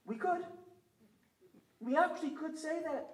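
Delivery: lightly voiced high, coy Haredi leaders; sly Haredi bargainer. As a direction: tease out the one small condition. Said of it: "the Haredim said something like".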